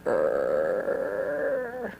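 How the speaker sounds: put-on low, drawn-out creak of an old door. Her heart like an ancient door, low creak. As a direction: groan it like a heavy ancient door swinging. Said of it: "like an ancient door going".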